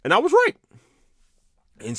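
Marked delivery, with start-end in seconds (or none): 0.0-0.5 high, loud triumphant squeal. Real, not acted. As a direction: crow it like you called it. Triumphant vindication.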